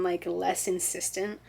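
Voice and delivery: normal talking voice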